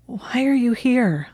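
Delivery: slow, high-pitched questioning; drawn-out, puzzled plea for explanation; incredulous question. A narrator's reading. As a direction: ask slowly and high, needing an answer. Acted bewildered demand, breath caught.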